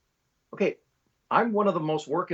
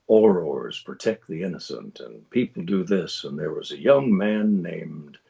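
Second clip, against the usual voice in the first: slow, low storyteller with a hint of Midwest; conversational trailer read; 7 semitones lower than usual. Shown as a demonstration. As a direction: narrate a film trailer, low and unhurried. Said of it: "much more conversational, much less trailer guy".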